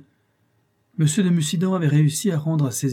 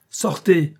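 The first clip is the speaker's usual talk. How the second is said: short, sharp command, higher and louder; curt angry order; 6 semitones higher, 6 dB louder than usual. A narrator's reading. Count ordering the schemer out, curt and angry. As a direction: throw him out in one clipped word. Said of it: "dit-il d'un ton bref".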